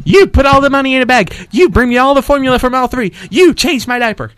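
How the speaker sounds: a hint of a demanding baby thug; mock baby robber. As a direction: play a bossy baby with a gun, not too far. Friends imagine a baby robber.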